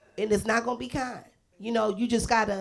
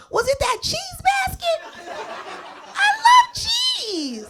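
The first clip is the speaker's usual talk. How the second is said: high, slow, laughing squeal; excited laughing shout about a cheese prize; clueless excitement; 6 dB louder, much slower than usual. Retold or imitated. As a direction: squeal over the cheese basket.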